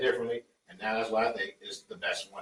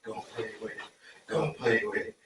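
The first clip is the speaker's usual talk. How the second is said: low raspy voice; 4 semitones lower than usual. Acted. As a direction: rasp it low like Irv. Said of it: "that low raspy voice".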